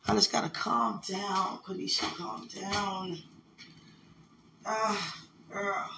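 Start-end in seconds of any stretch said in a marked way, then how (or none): none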